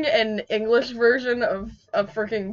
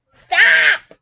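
high, loud squealed shout; playful protest; 12 semitones higher, 9 dB louder than usual. Real, not acted. Friend protesting touching feet, playful shout. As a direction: yell it through the giggles.

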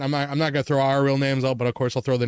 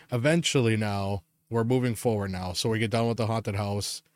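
ordinary talk

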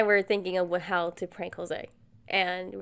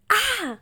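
short, high, loud fake yelp of pain; fake pain scream; 14 semitones higher, 6 dB louder than usual. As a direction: yelp as if the knife slipped. [name] fakes cutting finger.

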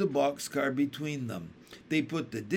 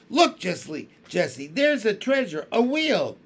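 put-on rising, louder child's call, bursting with glee; happy cry; 6 semitones higher, 7 dB louder than usual. A narrator's reading. Benny calls his sisters to the wheel, happily excited. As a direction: call it out like a kid spotting a prize. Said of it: "Suddenly he cried happily".

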